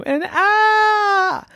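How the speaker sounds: crying out. Acted. Dinosaurs cry out.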